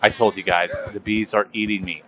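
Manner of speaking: ordinary delivery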